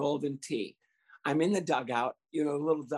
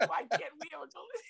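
mock wail; 12 semitones higher, 6 dB quieter, much faster than usual. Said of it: "I'd be screaming".

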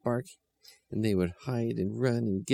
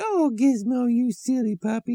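put-on sweet children's voice; 6 dB louder than usual. A narrator's reading. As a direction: coo at the puppy like a small child. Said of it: "And they said".

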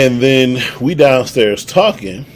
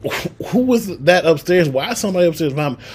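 higher-pitched, rushed disbelieving questions; retold alarmed questioning; 6 semitones higher, faster than usual. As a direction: fire off baffled questions, mildly acted out. Retold alarmed questioning about noises upstairs.

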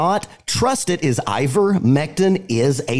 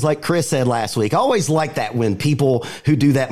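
usual speaking voice

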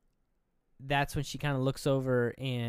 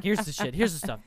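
higher, louder mock insistence; pushy urging; 5 semitones higher, 5 dB louder than usual. Imitating woman shoving the candle at her friend, pushy. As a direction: press it on them eagerly. Acting Joan pushing candle on Annie.

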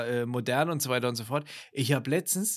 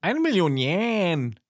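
game show host; 4 semitones higher, 6 dB louder, much slower than usual. Retold or imitated. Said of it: "Takeshis Castle?".

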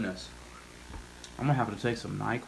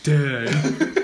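laughing dang; 2 semitones higher, 9 dB louder than usual. Retold or imitated. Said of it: "And you're like".

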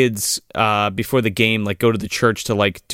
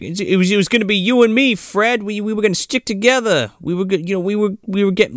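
high, fast, pleading whine; Clint Howard pleading; 10 semitones higher, faster than usual. Retold or imitated. Clint Howard pleading to Fred, high whine. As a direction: beg your only friend not to leave. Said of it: "he's like".

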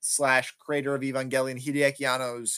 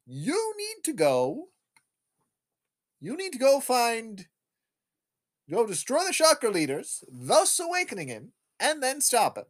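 high, swooping pronouncements; stagey mission briefing with exaggerated pitch swoops; imitated doctor; 7 semitones higher than usual. Doctor gives mission in retelling.

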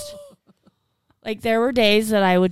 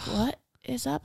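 soft puzzled mom; 4 semitones higher, 10 dB quieter than usual. Retold or imitated. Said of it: "she'd be like".

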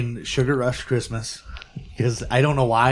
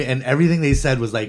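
usual speaking voice

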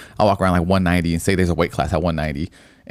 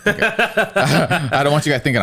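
slow, higher, laughing through the words; 8 semitones higher, slower than usual. Real, not acted. Host disclaims his meth jokes to listeners, laughing.

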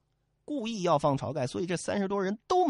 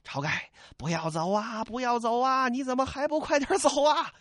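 calling after him; 6 semitones higher than usual. Acted. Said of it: "这得喊呢".

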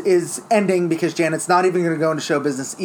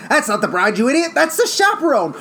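high, loud scolding; exasperated yell; 9 semitones higher, 3 dB louder than usual. Retold or imitated. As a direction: snap at him like an idiot. Gangster scolding Adolfo.

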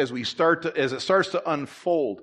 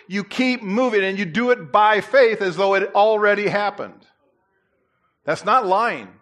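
louder, rising exhortation to step out in faith; preaching at full force; 5 semitones higher, 4 dB louder than usual. Acted. Pastor urging his congregation, preaching full force. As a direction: drive it home to the congregation, louder and higher.

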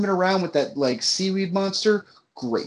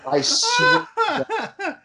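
laughing; slower than usual. Real, not acted. Recalling laughing at the scene.

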